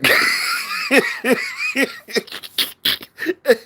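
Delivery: a sudden, very high cackle that bursts into a shout. Real, not acted. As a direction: burst out laughing at the top of your voice. Streamer cracks up about absent friends.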